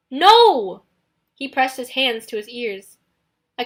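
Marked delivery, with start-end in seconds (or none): 0.1-0.8 high, very loud refusal; loud shout. Acted. Nico shouting.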